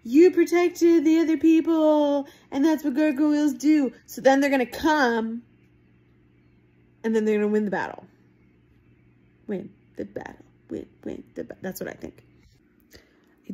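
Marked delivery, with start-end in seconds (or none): 0.0-3.9 louder, higher mock proclamation; hint of an army's solemn declaration; lightly voiced gargoyle declaration; gargoyle army proclaiming. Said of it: "gargoyle army is gonna go". 9.5-11.6 muttered aside. Real, not acted. Muttered repeat to herself.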